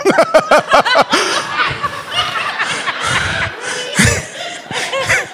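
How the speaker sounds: long high burst of laughter; real laugh. Real, not acted. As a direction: crack up at yourself. Laughs at own slip.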